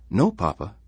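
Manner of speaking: put-on young David, uncertain; boy's voice. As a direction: voice a small worried boy. David answers father.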